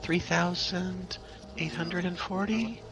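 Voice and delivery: high voice, slow and halting; hesitant potion seller. Acted. Seller quoting an invulnerability potion's price, high and hesitant. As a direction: stretch it out high, feeling for a price.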